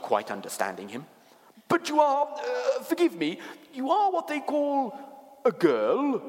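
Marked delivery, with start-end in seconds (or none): 1.7-6.2 high, halting faun voice; puzzled creature speaking high and hesitantly; curious, flustered faun. A narrator's reading. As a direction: feel your way to the word, high-pitched. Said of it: "said the faun".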